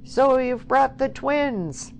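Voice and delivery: high, loud, drawn-out jeer; called out. A narrator's reading. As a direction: holler it teasingly across a crowd. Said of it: "called out".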